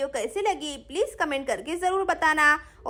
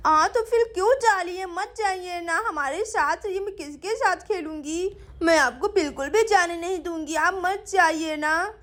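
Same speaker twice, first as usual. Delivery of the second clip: put-on lisping child begging a big sister to stay; pleading little girl. (मनुहार करती छोटी बच्ची की तुतलाती आवाज़)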